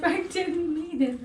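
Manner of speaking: high, slow teary plea; near tears. Retold or imitated. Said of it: "on the verge of tears".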